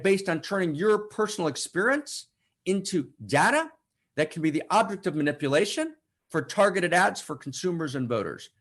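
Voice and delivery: usual speaking voice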